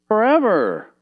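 high, loud called-out answer from the pews; congregation answering. Pretend. Preacher voicing the congregation, calling out high. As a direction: shout back the obvious answer. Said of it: "And you would say".

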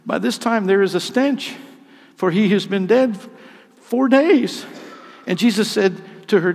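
high, loud pulpit delivery; impassioned scripture reading. A narrator's reading. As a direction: read the scripture out with mounting force. Dramatic scripture reading at raised force.

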